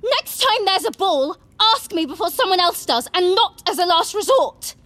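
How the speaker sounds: bellowing. A narrator's reading. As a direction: scream the accusation. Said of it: "bellowing at each other".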